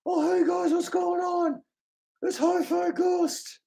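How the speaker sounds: very high cartoon ghost voice; High Five Ghost. Asked to do High Five Ghost, high cartoon falsetto. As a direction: greet the guys in a thin falsetto. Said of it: "Can you do High Five Ghost?".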